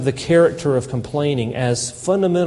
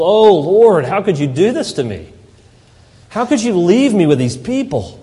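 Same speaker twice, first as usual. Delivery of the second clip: whining; 3 semitones higher, 6 dB louder than usual. Retold or imitated. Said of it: "And Moses whines".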